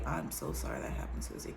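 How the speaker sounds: soft, hushed consolation; tender comfort. Acted. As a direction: console her gently. Jesus voiced comforting a victim.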